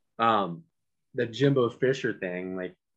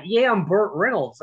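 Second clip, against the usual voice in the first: mock Southern accent; 9 semitones higher, 4 dB louder than usual. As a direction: ham up a fake Southern accent. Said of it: "pull the whole Southern accent thing".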